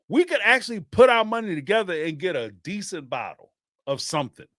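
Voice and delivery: ordinary delivery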